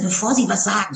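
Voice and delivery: normal talking voice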